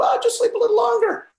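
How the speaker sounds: very high-pitched voice of the mind; tempting inner voice. Acted. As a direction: coax in a tiny, high put-on voice. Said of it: "my mind will go".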